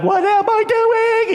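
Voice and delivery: slow, drawn-out high scream; mock panic. Friend careering down the hill, slow high panicked yell. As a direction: wail it in comic terror. [name] acted panicking downhill.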